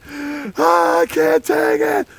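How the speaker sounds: high, slow whiny sob; mock crying wail. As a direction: whine it like a little kid. Retold crying soldier quitting.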